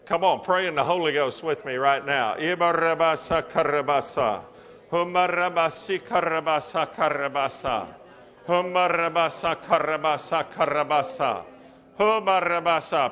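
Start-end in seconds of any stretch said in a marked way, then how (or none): 2.3-13.1 speaking in tongues. Real, not acted. Preacher praying in tongues.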